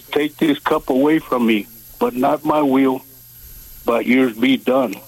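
usual speaking voice

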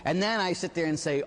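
normal talking voice

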